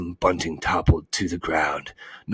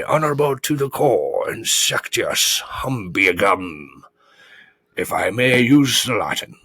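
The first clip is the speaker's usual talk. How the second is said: boastful, higher-pitched orator; pompous humbug; 5 semitones higher, 4 dB louder than usual. A narrator's reading. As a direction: puff up the family history grandly.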